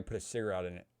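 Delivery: fast, "put a cigarette in it" smashed into one blur; words run together. Real, not acted. Telling friend how whiskey tasted, "cigarette in it" run together fast. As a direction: slur "cigarette in it" together quickly. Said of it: "run my sentence together like that".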